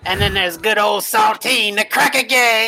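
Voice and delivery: Lightning Red voice. Acted. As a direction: roll out the insults, peaking on the last. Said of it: "And he he says".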